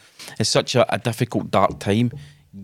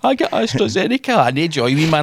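put-on much higher, whiny kid bragging about his present; mock child boast; 4 semitones higher than usual. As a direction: play a spoiled child showing off a Christmas gift. Mocking people comparing vaccine brands.

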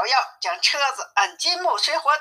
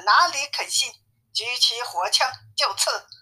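usual speaking voice

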